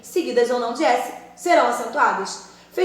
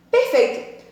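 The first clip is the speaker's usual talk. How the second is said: pleased praise; 10 semitones higher, slower than usual. Acted. Teacher praising imagined student.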